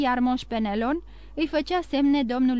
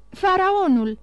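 higher, louder cry of Faraonul; joyful shout; 7 semitones higher, 5 dB louder, slower than usual. A narrator's reading. Call out the ship's name in joy. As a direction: Emanuel bursting in with the ship's news, shouting joyfully. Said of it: "strigă el".

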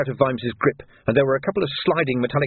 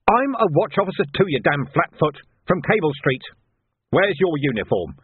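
high, indignant retort; angry protest; 6 semitones higher than usual. A narrator's reading. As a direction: insult the copper while protesting. Arrested man protests angrily to copper.

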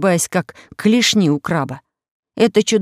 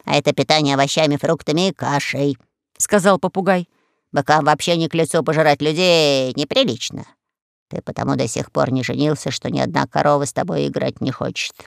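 parrot voice; 4 semitones lower than usual. Acted. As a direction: lecture like a know-all pirate parrot. Parrot lectures the Minotaur.

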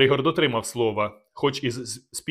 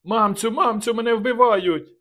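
high panicked cry for mother; terrified scream; 10 semitones higher, slower than usual. A narrator's reading. Young nobleman screaming for his mother in terror. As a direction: shriek in terror, much higher. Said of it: "раптом закричав".

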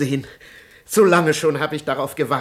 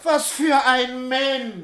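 empörter Aufschrei; 10 semitones higher, 3 dB louder, much slower than usual. Acted. Diener wiederholt fassungslos.

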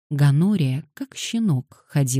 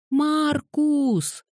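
higher, pleading whimpered call; pitiful call; 13 semitones higher than usual. Acted. Little girl pitifully calling Marcus to turn back. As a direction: beg him to turn around. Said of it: "невыносимо жалобным голосом позвала".

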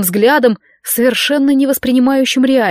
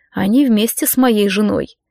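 ordinary delivery, nothing marked

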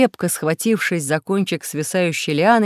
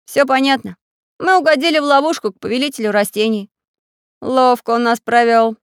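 high, loud dismay; upset; 6 semitones higher, 5 dB louder than usual. A narrator's reading. Самоделкин realising they were trapped, upset. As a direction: exclaim bitterly at being fooled. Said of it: "расстроился Самоделкин".

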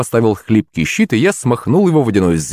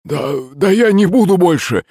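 panicked pleading; 8 semitones higher than usual. Acted. Drunk begs armed mother-in-law.

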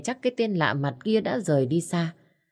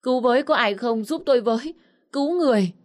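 high, loud panicked screams for help; much higher and louder, a panicked scream; frantic screaming; 8 semitones higher, 4 dB louder, faster than usual. A narrator's reading. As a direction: bang on the gate and scream for help. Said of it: "vừa đập vừa kêu la thất thanh".

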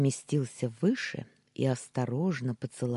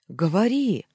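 loud, high, impatient command; impatient bark; 6 semitones higher, 9 dB louder than usual. A narrator's reading. Former boss urging her to speak, impatient bark. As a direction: bark it, demanding an answer now. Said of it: "нетерпеливо рявкнул".

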